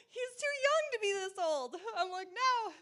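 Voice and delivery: high, loud retold wail; freaking out. Wife dreading husband's paralysis, hammed-up freak-out. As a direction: protest in exaggerated panic. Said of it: "So I'm freaking out".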